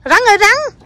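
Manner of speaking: high-pitched call to a snake. Shown as a demonstration. Mother showing her child how to call the snake, high calling voice. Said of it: "bây giờ con kêu rắn đâu".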